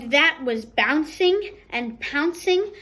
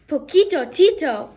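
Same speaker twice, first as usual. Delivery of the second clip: excited exclaim; 3 semitones higher, 6 dB louder than usual. A narrator's reading. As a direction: greet a friend with joy. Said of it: "exclaimed Skipito".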